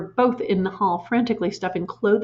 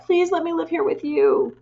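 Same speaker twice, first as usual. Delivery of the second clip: pleading; 9 semitones higher than usual. A narrator's reading. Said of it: "Matilda cried out".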